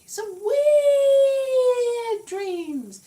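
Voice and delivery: high, loud, very slow ghostly drawl; mock ghost moan on the words; spooky wail. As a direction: stretch it out like a haunting. Spooky repeat of her dream remark.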